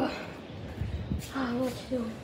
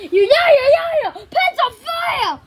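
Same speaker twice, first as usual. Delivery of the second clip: high, loud protest; high-pitched argumentative yelling; quarrelling shout; 8 semitones higher, 6 dB louder than usual. Acted. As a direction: yell over the other in a heated row. Acted quarrel boils over.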